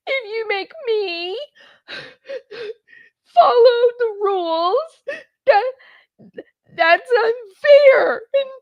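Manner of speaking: very high, drawn-out fake crying; mock sobbing. Mocking Lori pleading unfairness to the judge, fake sobbing. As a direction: bawl it haltingly in a squeaky, hammed-up wail.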